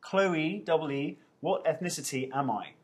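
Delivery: ordinary talk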